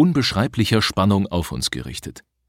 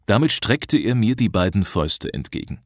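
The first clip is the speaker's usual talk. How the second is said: ordinary delivery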